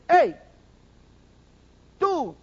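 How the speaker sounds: a high, loud "Hey, tú" calling out; accusing shout. Acted. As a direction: call him out from the crowd. Accuser calls out to Samuel.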